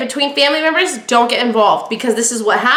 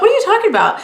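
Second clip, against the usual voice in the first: high, disbelieving question; high-pitched disbelief; incredulous; 10 semitones higher, much faster than usual. Retold or imitated. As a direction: act shocked at the accusation. Shocked reply to waiter's remark.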